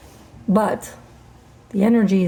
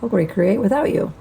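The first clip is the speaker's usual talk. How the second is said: normal talking voice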